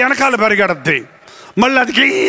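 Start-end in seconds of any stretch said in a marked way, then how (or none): 2.0-2.3 drawn-out dog noise; imitated dog whine. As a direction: squeal like a squabbling dog. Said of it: "అంటది".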